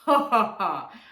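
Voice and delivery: loud, mocking ha-ha-ha; acted lion laugh. A narrator's reading. Lion laughing at the mouse, big staged laugh.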